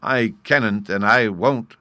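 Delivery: loud, stubborn refusal; defiant exclaim. A narrator's reading. Sylvie refuses the professor, loud and stubborn. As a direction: put your foot down hard. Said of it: "Sylvie exclaimed".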